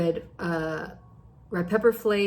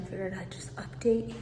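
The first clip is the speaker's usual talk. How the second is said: whispering; 5 dB quieter than usual. Real, not acted. Quiet update in the gym.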